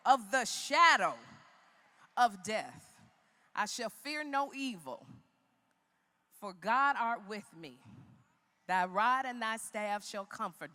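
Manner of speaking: measured recital of a psalm, pausing between phrases; scripture recitation. Acted. Speaker reciting a psalm to an audience, emphatic recitation. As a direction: build into emphatic, declaimed verse.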